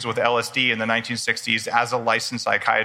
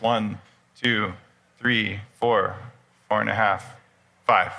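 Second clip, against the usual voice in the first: slow, lower counting, paced like a breath coach; guided breathing count; 3 semitones lower, slower than usual. Shown as a demonstration. Speaker guiding the audience through a box breath, slow calm counting. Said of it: "I can teach you guys right now a quick breath".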